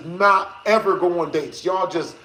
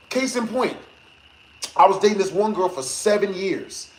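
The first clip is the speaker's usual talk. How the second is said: normal talking voice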